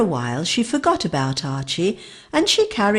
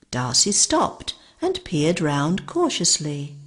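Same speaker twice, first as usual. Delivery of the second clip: ordinary delivery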